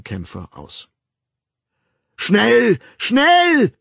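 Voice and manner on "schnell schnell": much higher, loud urgent yell. Acted. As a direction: bellow at the police to hurry. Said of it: "brüllte Bernhard".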